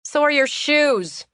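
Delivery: smug comeback called after the departing woman; called back. Acted. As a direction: fire a sarcastic parting jab. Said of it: "I called back".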